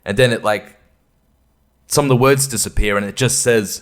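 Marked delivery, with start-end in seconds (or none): none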